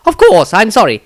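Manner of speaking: hasty, eager-to-please wizard; flustered wizard. Acted. Wizard apologizes.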